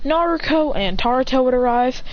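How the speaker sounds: usual speaking voice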